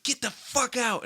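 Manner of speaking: high-pitched mock angry order, drawn out; grandpa's order. Grandpa walks back in on him, imitated angry order. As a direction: order them out as an outraged grandpa, slowly. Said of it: "he walks back in".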